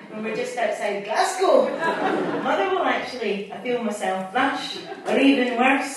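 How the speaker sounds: lively poetry reading, voicing the friend; poem reading. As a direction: read the poem aloud to the room, with energy. Reads her train poem aloud.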